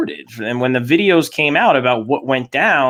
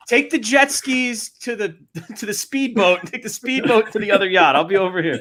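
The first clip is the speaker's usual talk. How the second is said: mock distant call; 8 semitones higher than usual. Rich guy directing someone to the speedboat, high mock calling. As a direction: shout directions playfully across water. Pretends to call across yachts.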